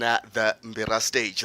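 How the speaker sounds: ordinary talk, nothing marked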